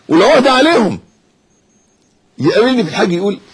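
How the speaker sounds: high, loud impassioned sermon; rousing, pleading preacher's voice; fiery preaching. Real, not acted. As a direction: preach at full force to the crowd. Preacher rises to full force.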